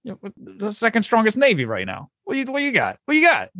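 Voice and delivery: high mocking challenge; taunting. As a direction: goad them, rising high. French side taunts opponent.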